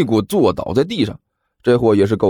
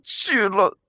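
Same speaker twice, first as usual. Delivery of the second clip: nervous resolve; 7 semitones higher, 4 dB quieter than usual. Acted. 犹豫后下决心.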